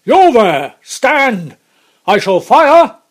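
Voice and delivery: loud, high barked commands; guard bellowing a threat into the mist; roared challenge. A narrator's reading. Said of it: "sang out as loud as he could roar".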